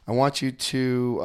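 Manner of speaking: normal talking voice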